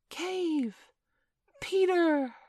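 high, tiny-star warning call. A narrator's reading. The smallest star warns Peter, calling out high. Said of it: "the smallest star of all called out".

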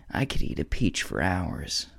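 low, soft, slow odd Cage line; Nick Cage impression. Listing actors' quirks, a low slow Nick Cage bit. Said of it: "Nick Cage has the, his thing".

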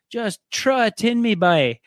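put-on loud, slow, thick Newfie accent; slow, drawn-out Newfoundland drinker's line. Friend imitating a drunk Newfie wife in a thick Newfie accent. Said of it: "he has a really good Newfie accent".

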